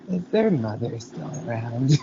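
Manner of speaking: low, soft imitated reply; lightly acted quiet menace; retold movie villain. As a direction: drop down softly into the character's line. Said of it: "And she's like".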